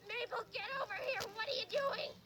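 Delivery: high-pitched scolding from far away; mock yell of a cross woman; lady yelling. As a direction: holler high across a lake. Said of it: "some lady was yelling".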